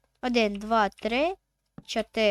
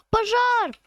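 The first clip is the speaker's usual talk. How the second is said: alarmed shout; 11 semitones higher, 6 dB louder than usual. Real, not acted. Character catches fire in game.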